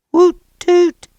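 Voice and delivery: high loud horn toots; party horns. A narrator's reading. Party horn sound effect.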